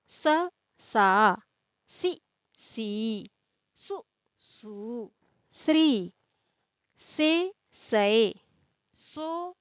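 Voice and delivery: slow, drawn-out chanting of syllable pairs; syllable drill recitation. Shown as a demonstration. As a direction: recite the vowel series like a teacher, evenly spaced. Recites syllable tables for children. (धीमे, खींचकर बारहखड़ी का पाठ)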